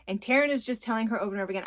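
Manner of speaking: usual speaking voice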